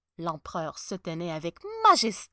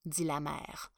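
usual speaking voice

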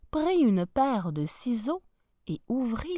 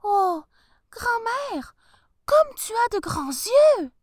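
put-on little girl voice; 13 semitones higher, 7 dB louder, slower than usual. Girl amazed at the eyes.

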